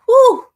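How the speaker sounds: big loud exhale of relief; loud whew. Real, not acted. After telling of the neighbor's death, a loud whew. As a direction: let it out loud and high. Releasing emotion after story.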